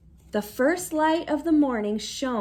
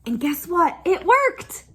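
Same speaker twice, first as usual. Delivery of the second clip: excited; 5 semitones higher, 6 dB louder than usual. Real, not acted. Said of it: "reader exclaims".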